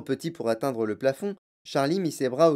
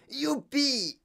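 put-on high-pitched boyish whoop; gleeful yell; 12 semitones higher than usual. A narrator's reading. Boy finds the television room button, whooping. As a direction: whoop with joy, without extra volume. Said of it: "hurla Mike T V".

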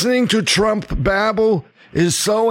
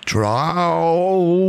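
Southern drawl. Shown as a demonstration. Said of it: "I can try".

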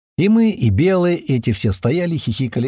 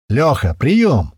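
slow, drawn-out radio call, higher; smiling repeat; 4 semitones higher, 4 dB louder, much slower than usual. A narrator's reading. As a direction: repeat the call slowly with a smile. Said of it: "улыбнувшись, повторил он нам".

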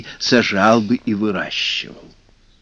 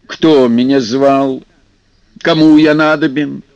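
gruff water spirit; 5 dB louder than usual. Acted. Vodyanoy demands.

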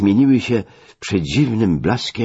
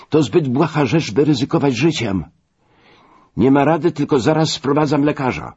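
insistent, high-pitched exhortation; appeal to conscience; 9 semitones higher, 3 dB louder than usual. Acted. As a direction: urge him firmly, pitched well up. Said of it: "przemawiając do jego sumienia". (naglące napominanie podniesionym głosem)

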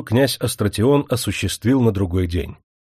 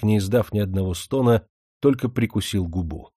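plain reading voice

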